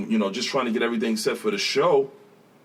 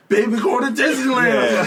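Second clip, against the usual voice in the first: excited; 11 semitones higher, 6 dB louder, slower than usual. Retold or imitated. Quoting himself crying on stage.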